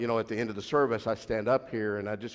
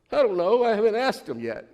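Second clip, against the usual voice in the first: put-on higher, louder sheepish girl voice; pitched-up unsure daughter; daughter's voice; 11 semitones higher, 7 dB louder than usual. Daughter admitting to dad she hasn't asked, high girlish voice.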